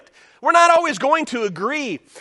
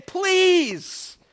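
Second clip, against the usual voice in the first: higher, earnest begging voice; pleading Paul; 8 semitones higher, slower than usual. Retold or imitated. Pastor voicing Paul begging the church, higher and pleading. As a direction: plead with them as Paul would. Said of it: "Paul is saying".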